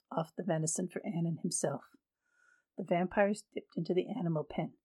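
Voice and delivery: ordinary delivery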